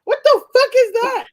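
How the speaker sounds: high, loud disbelief; outraged comic yell, pitch shooting up; appalled outburst. Acted. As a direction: shriek it as if utterly appalled. Acted outrage at date's vote.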